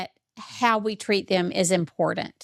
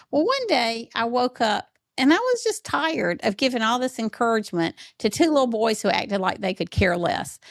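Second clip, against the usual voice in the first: normal talking voice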